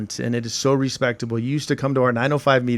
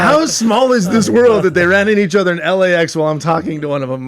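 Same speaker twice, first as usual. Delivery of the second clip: amazed quote; 5 semitones higher, 7 dB louder than usual. Retold or imitated. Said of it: "And I'm like".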